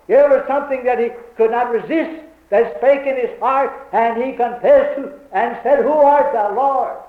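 loud, high-pitched preacher's delivery; impassioned preaching. Real, not acted. Preacher acting out Saul yielding to the Lord, impassioned and loud. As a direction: cry out the convert's confession with full conviction. Preaches Saul's confession at full force.

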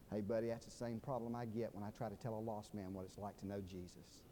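low, very soft, tender voice; hushed, low-pitched heart-to-heart; gentle consoling. Retold or imitated. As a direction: comfort a friend quietly. Friend consoling with arm round shoulder.

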